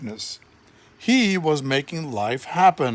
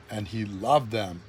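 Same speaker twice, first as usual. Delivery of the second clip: softer, lower, loving; tender; 7 semitones lower, 6 dB quieter than usual. A narrator's reading. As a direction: say it gently like a hug. Tender statement of love.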